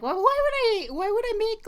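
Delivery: high scornful whiny voice; mocking dismissive parent. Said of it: "I really hate when people are like".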